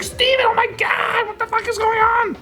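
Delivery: mock hysterical mom yelling; panicked mom. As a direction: shriek like a terrified mother. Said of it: "She's like".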